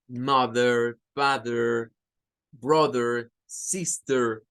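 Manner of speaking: "Mother, father, brother, sister" with the final R sounded; rhotic pronunciation. Shown as a demonstration. Teacher demonstrating the rhotic R on family words. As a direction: pronounce "brother" and "sister" ending on a clear R. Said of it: "El inglés rótico significa que la R suena".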